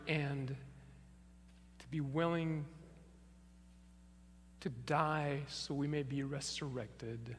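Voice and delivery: slow, soft closing prayer. Acted. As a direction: pray gently to close the service, lingering on each phrase. Pastor closes with a prayer.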